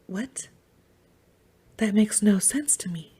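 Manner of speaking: hushed, breathy imitation of dad's disbelief; dad's baffled quote. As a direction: mimic dad, near-whispered, puzzled. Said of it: "He's like".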